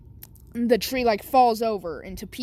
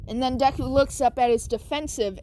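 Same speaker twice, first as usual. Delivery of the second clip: ordinary delivery, nothing marked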